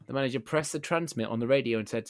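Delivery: ordinary talk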